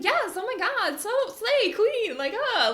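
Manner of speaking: put-on high, gushing hype-girl squeal; hype friend. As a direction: cheer like a bestie hyping you up. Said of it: "certain friends that'll just be like".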